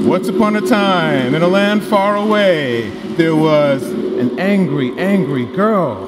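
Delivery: loud, drawn-out story time voice; storytelling voice. Shown as a demonstration. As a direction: tell it like to a room of toddlers. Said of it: "tell stories".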